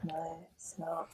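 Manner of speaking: slow, very soft whisper; whispered, reluctant disagreement; barely voiced. Real, not acted. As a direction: mumble it almost under your breath, slowly. Deflated reply about being stressed.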